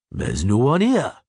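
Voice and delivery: rough, hearty dwarf voice; gruff dwarf. A narrator's reading. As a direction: own it, ashamed but brave. Zoltan voiced.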